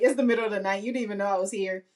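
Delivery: normal talking voice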